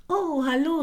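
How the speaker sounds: high, delighted hello; bright call in the dark; joyful greeting. A narrator's reading. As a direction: greet old friends happily. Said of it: "rief sie".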